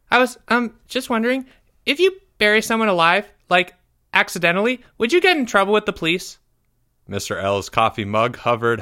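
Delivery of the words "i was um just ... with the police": put-on high, halting boy voice, then rushed; hesitant schoolboy. A narrator's reading. Pupil asking teacher about burying someone, sheepish high voice. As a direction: ask sheepishly in a small high voice.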